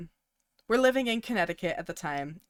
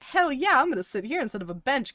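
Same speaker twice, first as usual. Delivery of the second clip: excited; 7 semitones higher, 4 dB louder than usual. Retold or imitated. Childhood excitement acted out.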